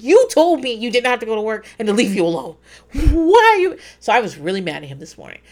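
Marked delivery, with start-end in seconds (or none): 0.0-3.8 loud, raised-pitch argument; mock-furious comeback, building to a high yell; exasperated retort. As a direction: throw it back in indignant disbelief. Retold quarrel with husband.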